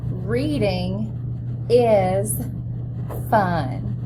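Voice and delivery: each word set apart, lifted; word-by-word model reading. Shown as a demonstration. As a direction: read one word at a time for the class. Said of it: "Let's read it together".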